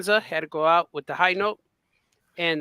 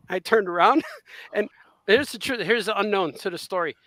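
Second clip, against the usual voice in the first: usual speaking voice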